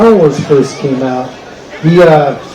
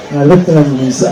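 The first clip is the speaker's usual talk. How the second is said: usual speaking voice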